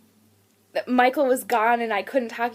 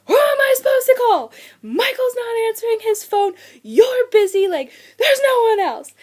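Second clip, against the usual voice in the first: high, loud mock wail; retold bawling; 11 semitones higher, 6 dB louder than usual. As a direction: act out a tearful, desperate outburst. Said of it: "I'm bawling in the middle of winter".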